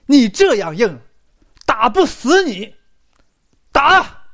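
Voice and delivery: loud, high, brutal roar building to a shout; furious thugs. Acted. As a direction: bellow the order to beat him. Thugs beating the young man.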